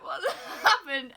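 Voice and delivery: slow, loud, laughing; laughing question. Retold or imitated. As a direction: ask while giggling. Mum asks, laughing.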